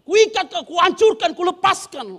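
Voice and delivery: loud, high rebuke of a demon; a mock deliverance-minister shout; casting-out shout. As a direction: bellow the exorcism command. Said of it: "kalau tengking setan ngeri loh".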